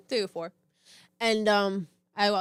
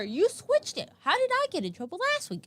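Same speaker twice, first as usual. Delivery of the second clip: higher, exasperated questioning; indignant retort; 11 semitones higher than usual. Retold or imitated. To the teacher who got mad, high incredulous retort. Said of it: "I literally said to her".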